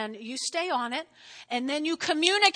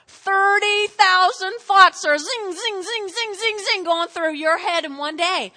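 loud, high, drawn-out start with rapid-fire zings; zinging thoughts; 6 semitones higher, 8 dB louder, slower than usual. Shown as a demonstration. Preacher showing thoughts racing through heads, animated. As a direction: make the thoughts whizz past, voice raised.

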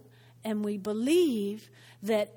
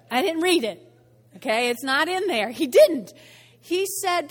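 normal talking voice

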